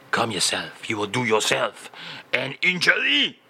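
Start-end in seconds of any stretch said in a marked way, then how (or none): none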